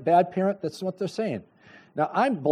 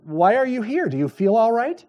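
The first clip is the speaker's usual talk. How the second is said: concerned question; 7 semitones higher, 4 dB louder than usual. Retold or imitated. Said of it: "I said".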